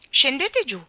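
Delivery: put-on loud high command to the children; commanding order. A narrator's reading. Orion ordering the stunned children down, loud command. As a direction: order them down sharply. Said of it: "ordinò Orione".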